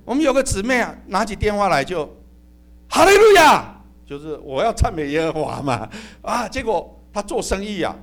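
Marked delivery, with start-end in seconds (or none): 2.9-3.7 high, loud phone greeting; bright phone hallelujah. Retold or imitated. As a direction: answer the phone with a cheerful shout. Sister answering the phone.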